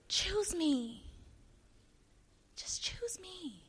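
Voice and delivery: pleading that fades to a whisper; hushed, breath-only entreaty; whispered plea. Acted. God's plea fading to whisper.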